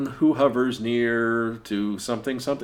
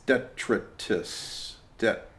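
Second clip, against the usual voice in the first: slow whispered detritus, tet-ruh-tis; whispered attempt; 4 semitones lower, 9 dB quieter, much slower than usual. Shown as a demonstration. Struggling to pronounce detritus, slow whisper. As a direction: breathe detritus out slowly, unvoiced. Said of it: "I can't pronounce that word".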